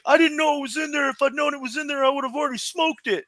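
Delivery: high-pitched retold self-defence; indignant protest. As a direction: protest your innocence, mock-earnest. Acts out friend's indignant defence.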